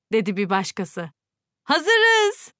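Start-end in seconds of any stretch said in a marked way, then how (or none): 1.6-2.5 put-on loud, high shout from a crowd of kids; all the children yell it together; high excited group shout; shout in unison. A narrator's reading. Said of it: "diye bağırdı hepsi bir ağızdan".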